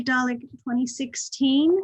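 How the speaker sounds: usual speaking voice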